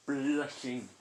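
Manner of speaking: "blushing" with a trilled, exaggerated start; emphatic "blushing". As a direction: stretch out "blushing" for drama. Storyteller dramatizes Ino's intense blushing.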